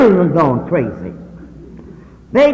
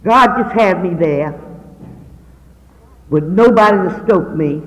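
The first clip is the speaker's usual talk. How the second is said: ordinary delivery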